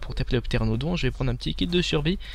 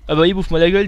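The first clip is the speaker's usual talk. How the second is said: higher, louder laughing outburst; loud exclamation; 5 semitones higher, 6 dB louder, faster than usual. Real, not acted. Player mauled by dinosaur, loud outburst. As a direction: cry out as you get mauled.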